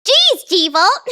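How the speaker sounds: high, loud squeaky cartoon voice; Kanari voice. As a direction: squawk it out like a cartoon bird. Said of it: "the Kanari voice".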